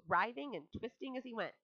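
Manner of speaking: usual speaking voice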